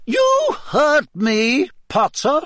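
high, loud, slow and final. Acted. Teacher shutting down Harry's protest, loud and final. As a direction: end the argument for good. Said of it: "in a very final sort of way".

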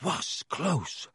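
out of breath; panting. A narrator's reading. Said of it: "panted Ron".

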